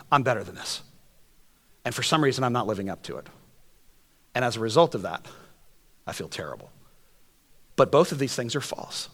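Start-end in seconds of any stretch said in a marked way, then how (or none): 0.1-6.7 fading confession. Acted. Preaching quietly, fading.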